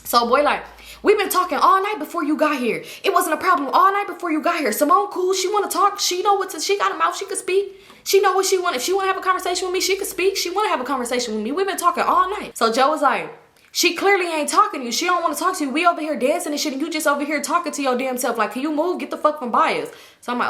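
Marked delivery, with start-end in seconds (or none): 1.0-12.6 mock heated argument. The classmate arguing with her boyfriend Joe, hammed-up heated protest. 13.7-19.9 mock telling-off. Boyfriend telling boy off.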